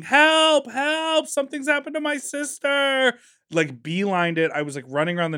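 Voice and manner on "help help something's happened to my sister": high, louder cries of help; mock panicked child yelling; retold yell for help. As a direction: call for help running barefoot.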